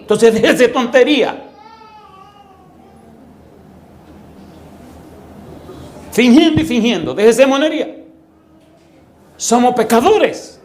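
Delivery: high, insistent exhortation; forceful preaching. Real, not acted. Preacher pressing congregation to admit.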